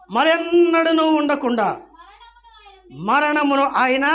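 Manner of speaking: loud, high held notes; full-force scripture proclamation. Real, not acted. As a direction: cry out the verse to the congregation.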